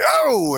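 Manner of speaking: high, drawn-out mock groan of alarm; distressed oh. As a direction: wail a hammed-up oh of stomach trouble. Acts out bubbling stomach distress.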